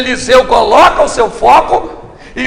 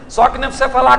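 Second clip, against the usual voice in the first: ordinary talk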